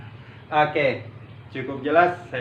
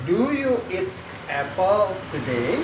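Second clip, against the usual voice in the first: slow, higher question, rising on TODAY; intonasi bertanya; 6 semitones higher, 3 dB louder than usual. Shown as a demonstration. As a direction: model it slowly, voice up on "today?". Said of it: "Tanda tanya". (nada bertanya, pelan, "today?" naik)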